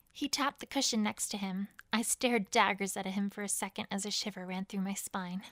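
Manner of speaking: usual speaking voice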